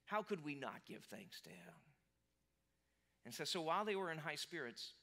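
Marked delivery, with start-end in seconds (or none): 0.1-1.7 whispered, savoring the victory; gloating whisper. Retold or imitated. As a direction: hush it like a gleeful secret. Gloating Philistines at captured Samson.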